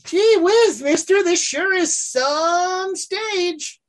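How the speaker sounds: put-on high, slow eager stable boy voice; gee-whiz farm kid, wide-eyed; wholesome 1950s kid. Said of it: "will take it and be like".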